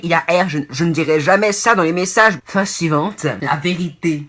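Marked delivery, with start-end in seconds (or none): 3.2-4.3 put-on slow, dragged street-tough accent on "la vérité"; mock Cassos accent. Presenting the next phrase in a Cassos accent. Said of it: "j'ai pris un accent de".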